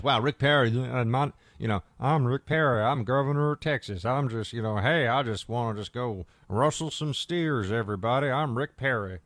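lightly voiced Texan cowboy governor, folksy; a half-hearted caricature of a folksy Texan; mock Rick Perry. Host mocking the paper's caricature of Perry, lightly voiced folksy Texan. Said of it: "It's not a good Rick Perry impersonation".

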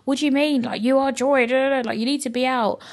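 high-pitched scolding reassurance, imitated; insistent friend. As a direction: imitate a friend brushing off the doubts. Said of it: "she was like".

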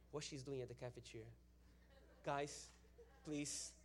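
soft, low, puzzled aside; hushed bewilderment. Retold or imitated. Preacher wondering about the woman, hushed bewilderment. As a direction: ask the others under the rising worry.

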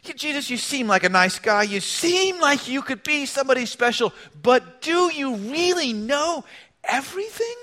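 polite doubt rising into a high-pitched question; squirming protest. Acted. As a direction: climb to a doubtful, high "everything?". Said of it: "So Peter protests. Peter squirms. He hems, he hums".

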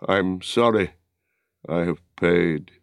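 a hint of a dying man's slow words; lightly voiced dying man. A narrator's reading. Wounded Boromir confesses to Aragorn, lightly voiced and weak. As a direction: confess it weakly, short of strength. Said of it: "At last slow words came".

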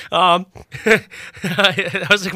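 ordinary talk, nothing marked

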